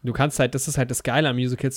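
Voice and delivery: ordinary talk, nothing marked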